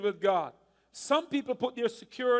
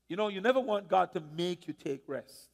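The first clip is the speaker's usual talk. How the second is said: normal talking voice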